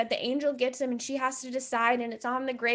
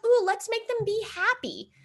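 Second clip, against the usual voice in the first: high, singsong mock glee; sarcastic fake cheer, high-pitched; mocking the writers; 9 semitones higher than usual. Mocks the writers' scheming.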